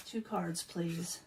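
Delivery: ordinary talk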